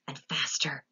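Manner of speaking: breathless whisper; hushed whisper. A narrator's reading. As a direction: whisper it as it fades. Accelerating elevator narration.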